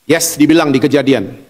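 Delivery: ordinary delivery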